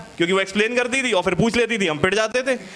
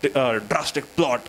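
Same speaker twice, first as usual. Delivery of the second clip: lower, extremely slow, theatrical teacher voice; imitating the teacher; 7 semitones lower, much slower than usual. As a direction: drag out the words like a dramatic English teacher. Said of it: "अब ma'am कह रही".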